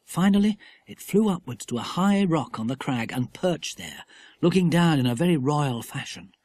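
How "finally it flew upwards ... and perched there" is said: plain reading voice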